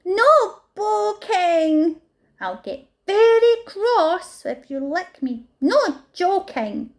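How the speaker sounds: high, loud, slow warning. A narrator's reading. Fluffalump tells the animals to stop poking, very loudly. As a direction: scold the pokers firmly. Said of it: "Fluffalump said very loudly".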